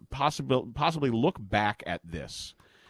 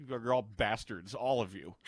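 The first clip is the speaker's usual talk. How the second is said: disgusted grumble; 3 semitones lower, 7 dB quieter than usual. Real, not acted. Grumbles at teasing co-hosts.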